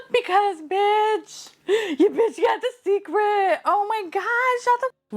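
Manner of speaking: very high, breathless with joy; real overjoyed high-pitched outburst; ecstatic squealing. Real, not acted. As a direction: squeal in thrilled disbelief. Thrilled at getting the secret.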